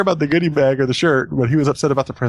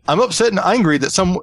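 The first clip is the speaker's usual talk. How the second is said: badly attempted British accent, higher pitched; 6 semitones higher than usual. Shown as a demonstration. Trying an angry runner's quote with a bad British accent. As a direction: try a British accent that slides toward Scottish. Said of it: "try it with the British accent".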